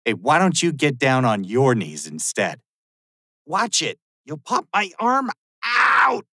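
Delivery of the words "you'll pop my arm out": high, slow strained cry of pain. Acted. Officer twists his arm during the arrest, pained cry. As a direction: yelp it out as the arm is wrenched.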